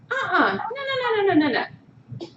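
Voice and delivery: high, whiny no no no; hammed-up whimpering no; mock upset refusal. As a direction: act like an upset person refusing to calm down. Acted angry refusal to calm down.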